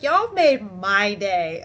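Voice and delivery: "my day" loud and drawn out; emphatic return compliment. Retold or imitated. Customer returning the supervisor's compliment, emphatic. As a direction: stretch "Y'all made my day" slowly, leaning on "my".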